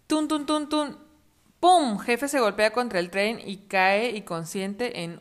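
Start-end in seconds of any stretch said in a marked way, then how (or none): 0.1-2.0 high chugging then bang; train sound effects. Acted. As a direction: imitate the train and crash. Sonido del tren y golpe. (efectos de tren y golpe)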